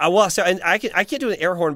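normal talking voice

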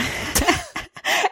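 airy high laugh; high breathy laugh. Real, not acted. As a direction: let out a breathy giggle. Laughs at own story.

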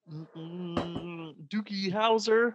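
high humming frog-puppet voice; Kermit the Frog. Acted. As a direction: squeeze out a high, hesitant puppet impression. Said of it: "that was an incredible impression".

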